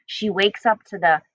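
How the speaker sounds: ordinary delivery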